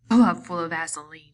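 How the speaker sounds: disgusted. A narrator's reading. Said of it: "he said disgustedly".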